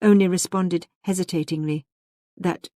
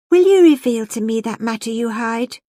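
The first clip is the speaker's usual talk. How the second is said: louder, higher petulant interruption; 5 semitones higher, 8 dB louder than usual. A narrator's reading. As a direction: cut in with a pettish, raised voice. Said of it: "she interrupted petulantly".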